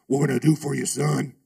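burly biker dude. Retold or imitated. Preacher voicing the biker at the door, gruff and loud. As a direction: growl it like a big burly biker. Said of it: "burly biker dude comes there and goes".

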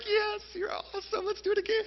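high, squeaky, softened cheer; mock gleeful praise. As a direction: cheer the child in an excited falsetto. Father praising the child he grabbed.

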